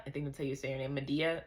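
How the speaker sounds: normal talking voice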